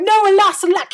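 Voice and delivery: high, loud anguished cry; the prince cries out as Cindy flees. A narrator's reading. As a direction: wail it as she slips away. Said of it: "The prince cried".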